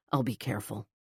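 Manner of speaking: soft, low and gentle. A narrator's reading. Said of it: "he said gently".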